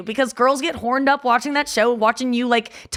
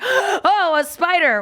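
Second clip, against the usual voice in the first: faked fright; 7 semitones higher, much slower than usual. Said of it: "had to pretend a spider was on me".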